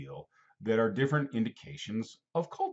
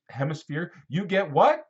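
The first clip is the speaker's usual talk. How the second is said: ordinary talk, nothing marked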